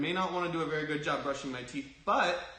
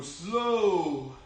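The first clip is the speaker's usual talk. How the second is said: long drawn-out "Slow"; 3 dB louder than usual. Shown as a demonstration. Coach cueing kids to punch in slow motion, slow. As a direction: stretch the vowel of "Slow". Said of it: "When I say slow, you're slowing it down".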